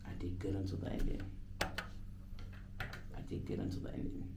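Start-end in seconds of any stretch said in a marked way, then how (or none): 1.6-4.2 slow whispered self-reassurance; whispered repeat. Real, not acted. DJ consoling himself after a flubbed take, slow whisper. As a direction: breathe it out to yourself, slowly.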